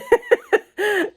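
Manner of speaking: high burst of laughter; laughing. Real, not acted. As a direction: crack up at yourself. Laughs at her own story.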